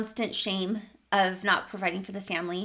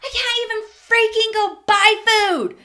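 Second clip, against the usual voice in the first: loud, high-pitched furious rant; piping angry; 11 semitones higher, 9 dB louder than usual. Retold or imitated. Venting to her sister about affording food, piping angry. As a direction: shout your outrage as you relive it. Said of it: "I was just piping angry".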